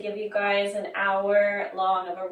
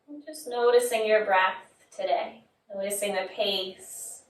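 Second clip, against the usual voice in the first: guided meditation. Acted. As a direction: guide them inward, leaving long pauses between phrases. Leads body scan meditation.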